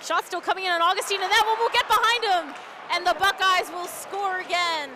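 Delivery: loud, high goal call; excited goal call. Real, not acted. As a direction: build to the goal and shout it.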